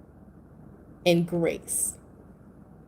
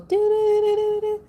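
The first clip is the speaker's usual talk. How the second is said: high sing-song do-do-do, much higher; imitated Facebook like jingle; 12 semitones higher than usual. Imitating the Facebook like sound to viewers, high sing-song. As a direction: mimic the chirpy notification tune way up high. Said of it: "it goes".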